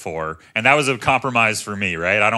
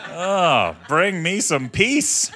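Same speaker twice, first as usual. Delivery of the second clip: usual speaking voice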